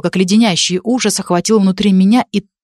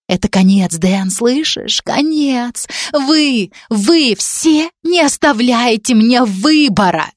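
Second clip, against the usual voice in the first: high, strained, slowing at the end; cornered desperation; 5 semitones higher, much slower than usual. Acted. Cornered girl telling Dan it's the end, desperate. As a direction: panic breaking through the threat.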